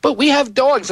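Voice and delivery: high-pitched pleading imitation; imitating an upset wife, high; mock tearful wife. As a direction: plead in a put-on weepy falsetto. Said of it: "my wife starts crying when she sees it".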